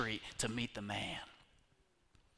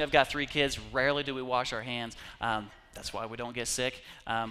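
normal talking voice

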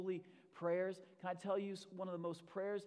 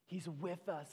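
usual speaking voice

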